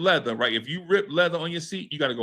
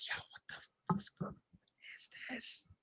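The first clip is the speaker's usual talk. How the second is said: breathless whispered disbelief; awed whisper; 18 dB quieter than usual. Retold or imitated. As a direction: hush it like you can't believe it. Gazing at the ceiling lights.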